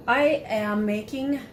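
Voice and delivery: ordinary talk, nothing marked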